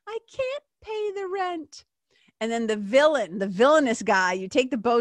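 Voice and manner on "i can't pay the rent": very high, slow helpless damsel. Acted. Cartoon girl tied to the tracks, pleading in a high helpless voice. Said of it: "the female character goes".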